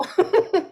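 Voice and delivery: brief, high-pitched chuckle; short laugh. Real, not acted. Host admitting the link scheme has ended, short high laugh.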